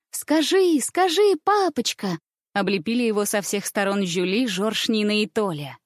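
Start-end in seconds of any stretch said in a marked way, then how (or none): 0.1-2.2 put-on high, insistent child's begging; pleading children. A narrator's reading. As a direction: beg Papa in a high child voice. Children beg their father. (упрашивающий детский голосок)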